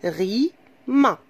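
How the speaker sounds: "Rima" broken as Ri... ma; split into syllables. Shown as a demonstration. Teacher dividing the word for children, "Rima" said syllable by syllable. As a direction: stretch "Rima" into two beats with a gap between. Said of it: "Alors, je divise".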